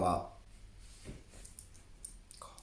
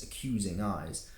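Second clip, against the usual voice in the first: usual speaking voice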